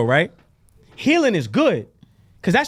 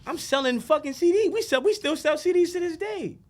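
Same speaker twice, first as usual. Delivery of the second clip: rising higher into amazed disbelief; excited, climbing higher; retold excitement; 9 semitones higher than usual. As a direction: replay the moment it hit you. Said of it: "And I said".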